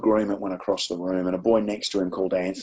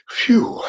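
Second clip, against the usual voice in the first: high, loud puff of relief; acted big exhale of relief; relieved phew; 4 dB louder than usual. Teacher after the kids agree, exaggerated relieved phew.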